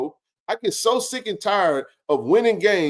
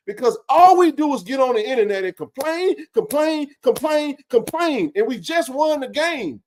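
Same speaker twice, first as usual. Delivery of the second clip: "complain" repeated, each one higher and exasperated; exasperated rant; 7 semitones higher than usual. Real, not acted. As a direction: hammer "complain" over and over in disgust. Frustrated rant about complaining fans.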